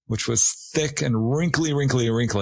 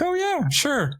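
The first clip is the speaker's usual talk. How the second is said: high, airy mock friend's reply; breezy friend. Friends casually confirming the beach walk, high and breezy. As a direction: toss it off lightly as a carefree friend.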